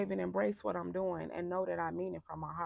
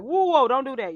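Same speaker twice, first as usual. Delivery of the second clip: loud, higher hyper whoa; 6 semitones higher, 12 dB louder than usual. Retold or imitated. Coach showing how hyper she gets, loud and high. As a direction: get hyped and loud. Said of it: "I may talk like".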